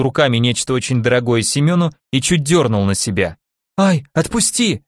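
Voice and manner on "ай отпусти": a higher, slower panicked plea. A narrator's reading. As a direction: beg him to let go, alarmed. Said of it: "запаниковал парень". (испуганно, в панике)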